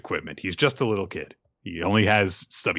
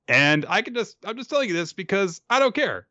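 higher, gloating aside; smug villain voice; 10 semitones higher than usual. Retold or imitated.

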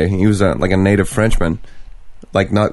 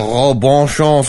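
mock French accent; 4 semitones higher, much slower than usual.

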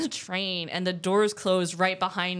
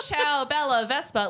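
higher-pitched faux Italian flourish; mock Italian; 5 semitones higher than usual. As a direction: imitate someone faking Italian. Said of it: "And I speak Italian".

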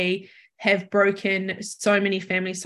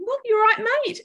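soothing cat; 13 semitones higher than usual. Retold or imitated. Comforting the dying cat.

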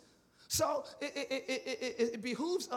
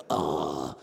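disgusted groan; 5 dB louder than usual. Retold or imitated. Mocking a tired churchgoer's groan.

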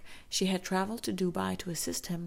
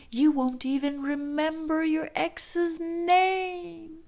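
high, loud, slow drawn-out promise; friend's ringing voice; 9 semitones higher, 5 dB louder, slower than usual. Retold or imitated. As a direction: stretch it out like a ringing echo. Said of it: "her friend's voice ringing in her ears".

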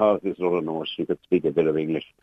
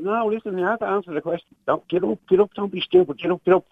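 urgent pleading; 10 semitones higher than usual. Retold or imitated. Urging her to get up.

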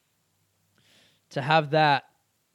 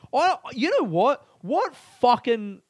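high, slow incredulous outrage; fired-up indignant burst; 9 semitones higher, 6 dB louder, slower than usual. Real, not acted. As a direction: bristle at the insult. Indignant rant outburst.

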